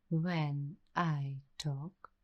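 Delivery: normal talking voice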